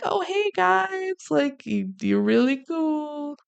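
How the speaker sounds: high, drawn-out gushing imitation; mock flirty greeting. Monica greets the hot guys who spot her, mock gushing.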